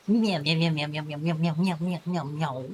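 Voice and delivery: meowing like a cat, trailing off; a string of cat meows, fading out; nervous cat voice. Pretend. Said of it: "Cats are buttholes? You tell me".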